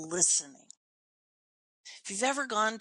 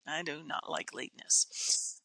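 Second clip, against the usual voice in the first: normal talking voice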